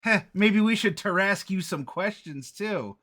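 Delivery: put-on high, loud jeering child; mocking kid. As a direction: taunt like a cheeky kid. Kid mocks the speaker.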